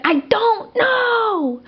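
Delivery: high, slow, snippy I don't know; rude reply. Retold or imitated. Retelling how she snapped at her dad's questions, snippy and slow. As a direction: drag it out, fed up and curt. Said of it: "And it was so rude".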